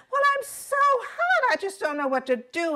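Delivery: high squeaky little squirrel, fast; high, quick little critter voice. Acted. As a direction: pipe it up like a small animal.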